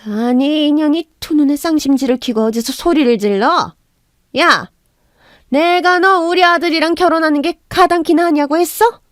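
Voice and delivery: mother-in-law berates her daughter-in-law, rising to a shout; furious scolding. Acted. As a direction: tear into the daughter-in-law, ending in a yell.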